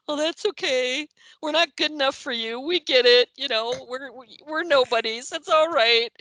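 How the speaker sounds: very high, loud mock wailing; fake crying. Band guilt-tripping writer who refused photo, fake crying. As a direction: whine like hammed-up sobbing to guilt someone. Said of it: "started, like, fake crying".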